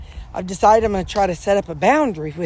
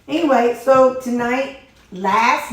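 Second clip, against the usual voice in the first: energetic; 6 semitones higher, 6 dB louder, slower than usual. Real, not acted. Brisk topic change.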